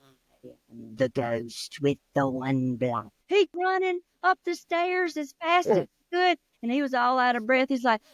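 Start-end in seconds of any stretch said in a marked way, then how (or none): 0.0-3.1 low, soft ghost moan; spooky ghost voice. Acted. Ghost in the basement, low and soft. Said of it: "and it said".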